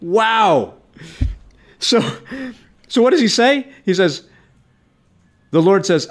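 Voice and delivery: usual speaking voice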